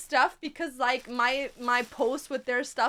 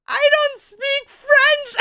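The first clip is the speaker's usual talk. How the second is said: retold helpless reply; 10 semitones higher, 12 dB louder, slower than usual. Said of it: "I was like".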